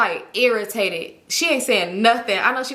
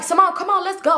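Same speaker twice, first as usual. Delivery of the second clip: impatient friend; 8 semitones higher than usual. Retold or imitated. Friend urging her to leave.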